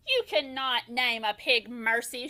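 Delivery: plain reading voice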